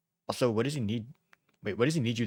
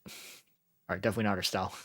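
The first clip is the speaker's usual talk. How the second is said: normal talking voice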